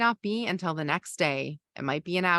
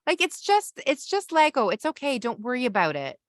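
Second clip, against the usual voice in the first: high, bright, sing-song reassurance; cheerleading; 7 semitones higher, 3 dB louder than usual. Shown as a demonstration. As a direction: brush it off like an upbeat cheerleader.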